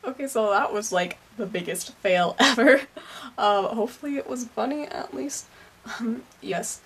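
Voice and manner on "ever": normal talking voice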